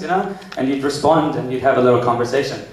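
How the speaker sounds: ordinary delivery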